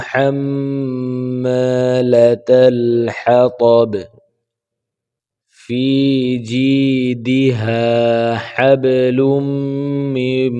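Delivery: slow, melodic tajweed recitation; Quran recitation. Shown as a demonstration. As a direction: recite each phrase slowly with a long sung line. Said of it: "আমি একবার reading পড়ে যাচ্ছি".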